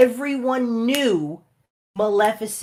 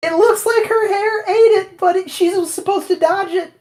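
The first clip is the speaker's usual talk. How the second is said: giggly, higher and louder; laughing while talking; 12 semitones higher, 6 dB louder than usual. Real, not acted. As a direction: talk through laughter. Laughing at bad scene.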